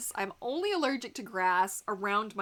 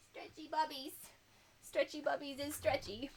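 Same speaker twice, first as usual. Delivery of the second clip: lightly higher cooing pet talk; 6 semitones higher, 6 dB quieter than usual. Real, not acted. Playful baby talk to her dog. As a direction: coo nonsense at the dog.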